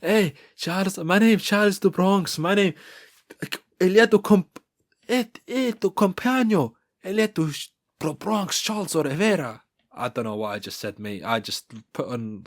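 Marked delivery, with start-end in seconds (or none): 0.0-9.6 high-pitched, badly faked Portuguese accent; mock Portuguese accent. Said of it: "trying to do a Portuguese accent".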